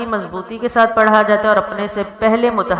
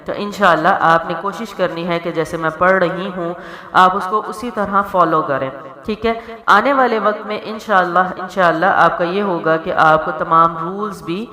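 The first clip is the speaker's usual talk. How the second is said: ordinary talk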